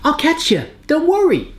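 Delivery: high, cartoonish voice for the grips; a high toy voice that reassures the corn; grips talking. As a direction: voice the grips like kind little helpers. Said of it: "They're like".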